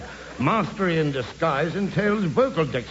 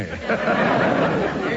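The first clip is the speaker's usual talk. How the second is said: hearty laugh; 7 semitones higher than usual. Acted. Laughs at own joke.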